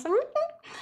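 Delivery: a high, mocking hum; sarcastic high hum to brush off Katniss; sarcastic mm-hmm. Haymitch answers sarcastically.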